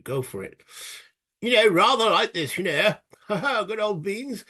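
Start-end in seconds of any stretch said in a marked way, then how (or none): 1.4-4.4 high, hammed-up British toff parody; mock British accent. Showing viewers a piss take, mock British accent. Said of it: "piss take of a British accent".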